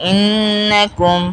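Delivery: slow "ইন্নাকুম" with held double n; "ইন্নাকুম" recited slowly, the n sounded twice; doubled nun. Shown as a demonstration. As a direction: linger on the nn of "ইন্নাকুম". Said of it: "দুইবার উচ্চারণ হয়".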